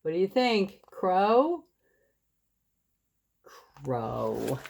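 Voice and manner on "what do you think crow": ordinary delivery, nothing marked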